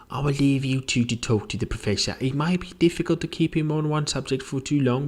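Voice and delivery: high, brisk housekeeper voice. Acted. Housekeeper leaving two friends to talk, high and brisk. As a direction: excuse yourself in a high, quick voice. Housekeeper takes her leave.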